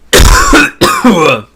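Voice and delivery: loud high hacking cough; coughing up water. Real, not acted. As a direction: hack it out, choking. Still choking on water.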